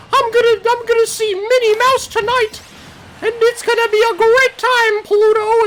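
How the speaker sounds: very high, loud cartoon falsetto; Mickey Mouse. Cartoon mouse telling his dog about tonight's date, high gleeful falsetto. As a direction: squeak it out like an eager cartoon mouse. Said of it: "Mickey Mouse has taken Pluto ... and he's like".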